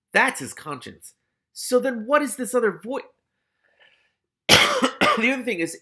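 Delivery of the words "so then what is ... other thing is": high, loud, eager wondering; excited puzzling. Real, not acted. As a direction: get worked up over the riddle.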